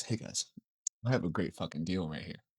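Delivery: very soft, low acted voice; hushed, deep mock scheming; conspiratorial pitch. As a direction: quietly let the gang in on a deal. Voicing lion conspiring with others.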